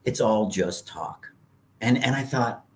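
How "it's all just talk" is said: grandma's dismissal. Retold or imitated. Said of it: "And she goes".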